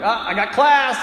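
loud, high comic protest; acted student's loud whine; excuse-making yelp. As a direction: blurt the excuse out. Student makes a relieved excuse, loudly.

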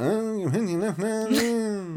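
sing-talk it slow like a folk singer. Pretend.

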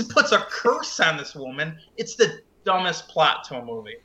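ordinary talk

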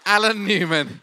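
loud, lifted announcer build-up; announcer intro. Acted. Host announcing his friend to the church, loud announcer style. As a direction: present him like a showman.

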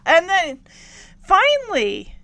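ordinary delivery

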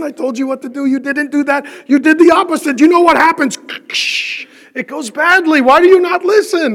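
mock exasperated shouting at someone who won't listen; exasperated scolding. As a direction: scold them like they never listen. Imagined scolding, voice rising.

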